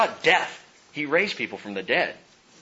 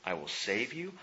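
much softer, hushed and tender; God's soft promise; 2 semitones lower, 12 dB quieter than usual. Acted. Pastor voicing God's promise to the hurting, hushed softly. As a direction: breathe the promise gently. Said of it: "but I did say".

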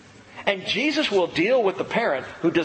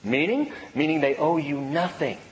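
normal talking voice

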